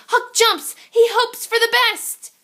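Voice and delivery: usual speaking voice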